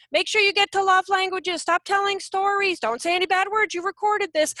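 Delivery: a hint of tiny nagging squirrels; squirrels holding signs. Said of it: "they're going like that in my head with signs".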